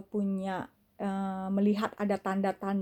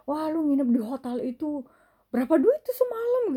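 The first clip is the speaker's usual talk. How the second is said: price question climbing much higher and louder; nosy mock question; 6 semitones higher, 3 dB louder than usual. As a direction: play a nosy friend prying about money. Mimicking a nosy asker. (teman kepo menanyakan harga dengan nada tinggi)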